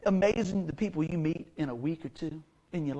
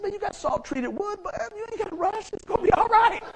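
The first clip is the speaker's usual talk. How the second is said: high-pitched retort; 12 semitones higher than usual. Retold or imitated. Acts out retort to impatient man.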